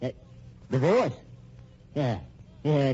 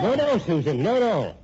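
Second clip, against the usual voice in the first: firm loud command; 8 semitones higher, 5 dB louder than usual. Acted. Husband stops Susan leaving, gun drawn.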